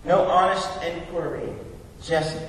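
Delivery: ordinary talk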